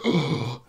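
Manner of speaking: high imitated gasp of pain; shocked gasp. General stabbed by lightsaber, imitated shocked gasp. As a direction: gasp as if run through. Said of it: "the General's like".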